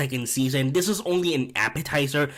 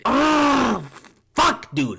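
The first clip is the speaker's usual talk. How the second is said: higher, louder fed-up groan and curse; disgusted groan; 9 semitones higher, 4 dB louder, much slower than usual. Real, not acted. Venting to viewers about the finale, disgusted groan. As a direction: groan like you can't believe it. Ranting about Oz's betrayal.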